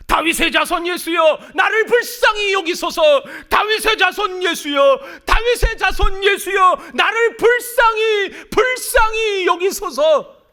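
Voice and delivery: desperate crying out. Acted. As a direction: scream for mercy at the top of your lungs. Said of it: "그 사람의 소리가 훨씬 더 선명하게". (절박하게 울부짖는 외침)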